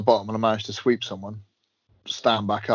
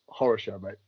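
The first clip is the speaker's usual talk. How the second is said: normal talking voice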